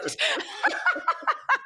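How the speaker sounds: high burst of laughter; big laugh. Real, not acted. Laughing at her partner's blunt quip, a big high laugh.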